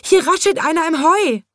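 high, loud alarmed call; frightened zebra. A narrator's reading. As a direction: shout the alarm, still scared. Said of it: "schrie in diesem Augenblick das Zebra".

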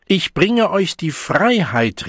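high, slow, loud announcement; proclaiming cry. A narrator's reading. The Savage addresses the Deltas, crying out. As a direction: proclaim it to a crowd. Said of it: "rief der Wilde".

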